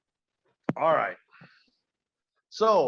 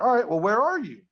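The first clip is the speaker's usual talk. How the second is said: raised, higher-pitched searching shout, acted; calling out; 6 semitones higher, 5 dB louder than usual. Man searches for the drunk stranger, acted calling out. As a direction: call out to someone you can't see.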